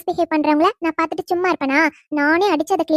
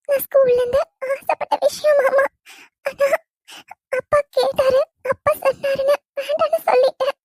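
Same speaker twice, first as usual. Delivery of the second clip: high, tearful pleading to her uncle; crying; 6 semitones higher than usual. Acted. Niece crying to her uncle about giving up basketball, tearful. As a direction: sob it out, voice breaking. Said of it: "முதல்ல அழுகையை நிறுத்து".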